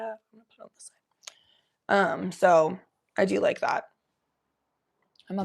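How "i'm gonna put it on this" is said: much softer whispered aside. Real, not acted. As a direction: whisper it to yourself.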